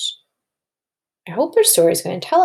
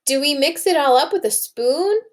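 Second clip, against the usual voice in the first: a higher, lightly voiced little girl asking; child character; 7 semitones higher than usual. Teacher voicing Tess asking the cooking teacher, higher. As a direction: ask it like an eager little girl. Reads Tess's line to class.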